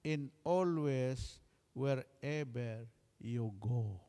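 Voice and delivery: slow, solemn preacher's benediction; slow blessing. Acted. Pastor blessing the congregation, slow and solemn. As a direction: draw out each word like a blessing.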